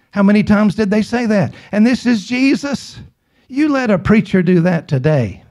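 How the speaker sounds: ordinary delivery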